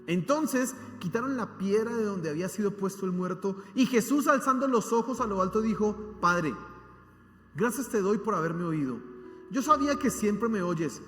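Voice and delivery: loud, impassioned preacher reading the Bible aloud; fervent scripture reading. Acted. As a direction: proclaim the passage to the congregation. Reads scripture at full force.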